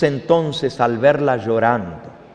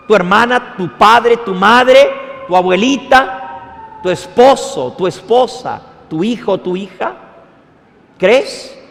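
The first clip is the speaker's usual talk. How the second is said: loud, higher, impassioned appeal; preaching at full force; 7 semitones higher, 6 dB louder than usual. Real, not acted. Preacher pleading with the congregation to believe, fervent. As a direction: hammer each name home.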